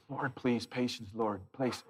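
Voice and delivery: low, soft muttered prayer; praying for patience through teeth. Retold or imitated. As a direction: beg God for patience under breath. Husband praying under breath.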